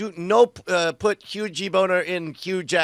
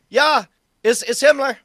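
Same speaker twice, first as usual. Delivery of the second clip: high, loud answering as Himmler; 5 semitones higher, 5 dB louder than usual. Pretend.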